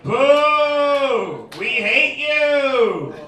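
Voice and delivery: loud, drawn-out jeer; booing crowd. Retold or imitated. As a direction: imitate a hostile audience, high and stretched out. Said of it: "And they were like".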